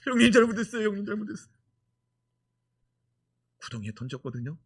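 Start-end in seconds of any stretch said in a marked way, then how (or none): 0.0-1.5 pleading Joseph. Retold or imitated. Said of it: "또 어떻게 했을까요".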